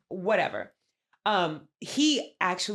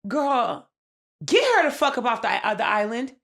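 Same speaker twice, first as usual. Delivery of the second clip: exasperated demand; 4 semitones higher, 5 dB louder than usual. Real, not acted.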